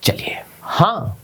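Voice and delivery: slow unvoiced whisper. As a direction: reassure her in a slow whisper. Whispered reassurance. (धीमी फुसफुसाहट में)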